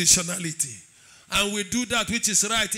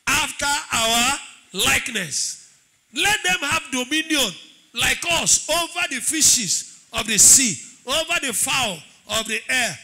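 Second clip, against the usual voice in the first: emphatic scripture reading; 4 semitones higher, 5 dB louder than usual. Acted. Preacher reading God's words from Genesis, emphatic proclamation. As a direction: proclaim each phrase with weight and authority. Reads scripture aloud as God speaking.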